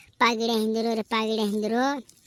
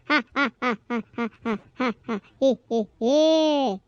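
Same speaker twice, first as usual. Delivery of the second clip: staccato "ha ha" into long drawn-out "hee"; hammy evil laugh; villain cackle; 2 semitones lower than usual. Acted. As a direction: laugh in separate beats, then stretch the last one. Villain laughs after his boast.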